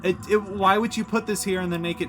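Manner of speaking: loud, high-pitched frustration; real exasperated outburst, high and loud. Real, not acted. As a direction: complain loudly at the game, voice jumping up. Exasperated at the game.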